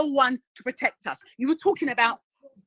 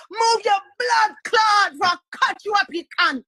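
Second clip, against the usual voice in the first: aggressive shout; 10 semitones higher, 7 dB louder than usual. Shown as a demonstration. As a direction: bark it out as a street threat. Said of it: "You do have to say".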